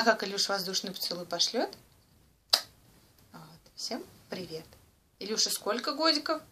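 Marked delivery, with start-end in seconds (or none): 3.3-4.6 whispered greeting prompt; whispering. Real, not acted.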